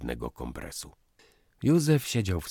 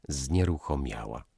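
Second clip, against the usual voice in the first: ordinary delivery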